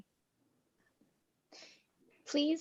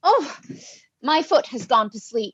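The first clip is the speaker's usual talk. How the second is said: much louder grumble; loud complaint; 3 semitones higher, 13 dB louder than usual. Acted. Steward complaining as he stands up, loud grumble. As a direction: complain loudly as you rise.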